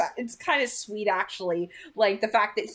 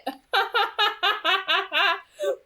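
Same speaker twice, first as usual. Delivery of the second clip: bright amused laugh, pitched up; real giggle at the book; 9 semitones higher than usual. Real, not acted. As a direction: burst out laughing at the memory. Laughs after teen boys rant.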